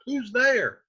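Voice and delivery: high mock call toward the door. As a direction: act out calling to whoever knocks. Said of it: "talking back and forth to the door".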